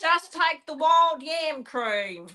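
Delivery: slow, high and drawn-out herbalist voice; lightly voiced earnest herbal healer; impression of a herbalist.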